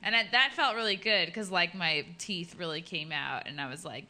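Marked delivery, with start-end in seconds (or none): none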